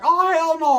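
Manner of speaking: high, loud mock outrage; outraged cry. Cousin hearing rivals are moving into his town, imitated outraged cry. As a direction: yell it high, in disbelief.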